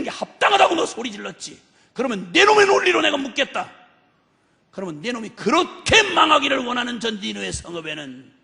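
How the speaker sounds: mounting, emphatic rebuke; forceful preaching as God. Acted. God turning Jonah's own logic on him, forceful rebuke. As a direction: build into loud, insistent argument.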